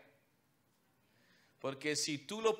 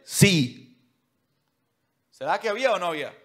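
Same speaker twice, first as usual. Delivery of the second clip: loud, driving preacher's voice; fiery preaching; 5 semitones higher, 8 dB louder than usual. Acted. Preacher to congregation, loud fiery preaching. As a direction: preach it out full force to the congregation.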